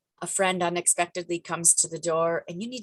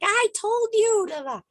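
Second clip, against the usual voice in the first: much higher, slow exasperated whine; mock frustration; 5 dB louder than usual. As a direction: ham up an irritated complaint. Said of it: "you could have been frustrated".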